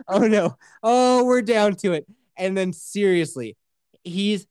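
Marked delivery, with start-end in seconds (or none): 0.1-2.1 mock dread.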